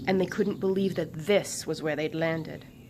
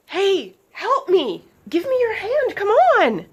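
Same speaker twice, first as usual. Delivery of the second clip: much higher, loud pleading shout; calling for help; 14 semitones higher, 11 dB louder, slower than usual. A narrator's reading. Swift calling up to her teammate for help. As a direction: yell up the stairs for help. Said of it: "Swift called back up the stairs".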